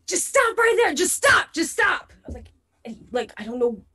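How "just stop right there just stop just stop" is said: high, repeated halt command; urgent warning. Acted. Player orders the approaching stranger to stop, urgent high warning. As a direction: call out to halt someone approaching.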